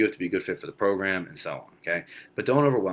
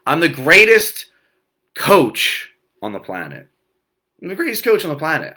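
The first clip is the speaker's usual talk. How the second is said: proud affirmation; 6 semitones higher, 9 dB louder than usual. Shown as a demonstration. Coach proudly declaring his own affirmation. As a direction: proclaim it like the greatest. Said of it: "I told you some of mine".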